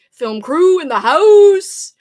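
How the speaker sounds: cheer. Real, not acted. Cheering her team.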